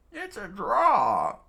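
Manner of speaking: loud, high, drawn-out shout; stretched-out high yell; screaming. Acted. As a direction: holler it slowly, swelling on the last word. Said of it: "Crocus screamed out".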